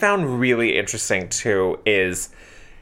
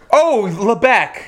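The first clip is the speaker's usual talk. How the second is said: lightly played distracted lover, pitched up; drawn-out, higher "oh" as if just reminded; hammed-up belated realization; mock sudden remembering; 8 semitones higher, 4 dB louder, slower than usual. Acts out Charlie suddenly remembering Lebec.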